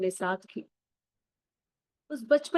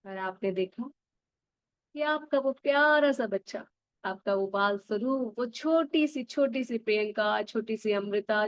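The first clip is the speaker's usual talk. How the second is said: put-on calm guide drawing the listener back to childhood; guided meditation. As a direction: lead them gently into the memory. Guides inner-child visualization.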